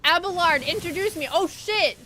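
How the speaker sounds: loud, high excited shout; excited call. Real, not acted. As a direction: call out eagerly, then panic.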